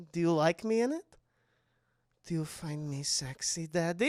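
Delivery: hushed, creepy come-on; imitated seductive whisper. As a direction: whisper it slyly, imitating Esther. Imitates Esther seducing her father.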